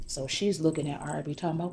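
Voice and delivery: ordinary delivery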